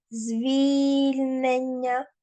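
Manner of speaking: звільнення very slow, stress on звіль-, not on the ending; unstressed suffix. Shown as a demonstration. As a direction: stretch the first syllable of звільнення. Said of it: "А без наголосу ення".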